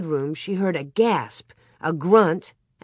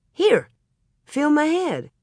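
urgent; 9 semitones higher than usual. A narrator's reading. Pa urges wife to feel.

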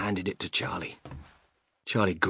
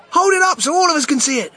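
eager shout; 9 dB louder, faster than usual. Acted. Crowd member calling out.

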